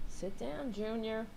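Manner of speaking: gently settling the dog down; soft dog command. Real, not acted. Owner telling her dog to sit, softly.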